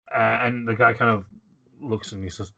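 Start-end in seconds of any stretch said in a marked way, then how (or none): none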